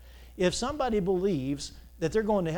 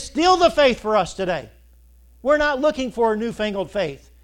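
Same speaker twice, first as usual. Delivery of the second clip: preaching at full force; 8 semitones higher, 11 dB louder than usual. Acted. Preacher to his congregation, building to a loud sermon peak. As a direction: hammer home the point from the pulpit.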